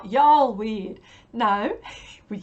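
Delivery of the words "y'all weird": very loud, slow, bad Southern US accent; attempted Southern accent. Vlogger retrying a viewer's phrase, exaggerated Southern accent. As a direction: stretch it out in a hammed-up American South accent. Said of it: "or a, a Southern accent".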